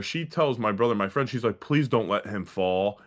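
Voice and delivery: ordinary talk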